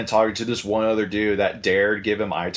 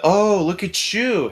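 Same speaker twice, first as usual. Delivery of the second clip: higher, drawn-out mock come-on; imitated flirting; 7 semitones higher, slower than usual. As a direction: play a drunk guy laying it on slow. Retold drunk man hitting on women.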